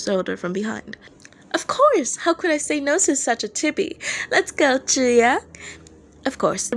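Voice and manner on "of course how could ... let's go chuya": playful Dazai voice. Acted. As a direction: throw an arm round him and crow it.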